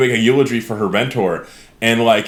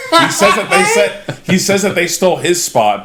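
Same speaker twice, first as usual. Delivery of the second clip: laughing; 8 semitones higher, 4 dB louder than usual. Real, not acted. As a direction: laugh through the telling. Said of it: "laughing while telling".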